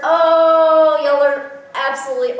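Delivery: long, high, loud "oh"; delighted squeal. Real, not acted. Unboxer adores the shirt, delighted squeal. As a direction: swoon loudly over a gift.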